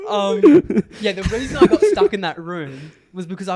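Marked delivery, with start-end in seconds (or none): 0.1-0.4 high, loud laughing fit; helpless laughter. Real, not acted. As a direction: laugh until it turns to a wheeze.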